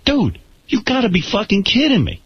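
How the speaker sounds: high, outraged disbelief; mock outrage at the photographer; incredulous. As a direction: act like it's absurd. Disbelief at thumbs-up request.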